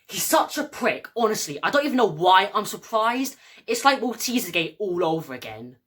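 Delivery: high, agitated venting; indignant, worked-up complaint; exasperated rant. Acted. Acted rant about best friend.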